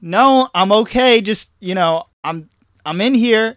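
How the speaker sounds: high, slow, loud reassurance; hammed-up awkward kid reply; calling back. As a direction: fend off mom from inside the stall. Kid calls from bathroom stall.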